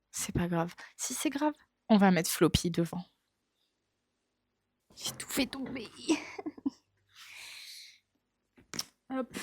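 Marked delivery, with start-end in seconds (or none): 5.0-9.4 whisper. Real, not acted. Objets tombés, chuchote en riant.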